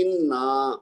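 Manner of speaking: انا with the final aa drawn out; double-length vowel. Shown as a demonstration. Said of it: "اس کو ہم نے double پڑھنا ہے". (انا کے آخر کو کھینچ کر)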